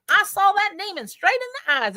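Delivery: high, mock-dramatic boast; mock boastful claim. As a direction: parody a breathless ghost hunter. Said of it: "they're like".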